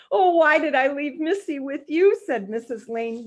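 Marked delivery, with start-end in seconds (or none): 0.1-2.2 lightly voiced fretting mother; exasperated mother. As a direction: wail it with regret. Missus Lane regretting.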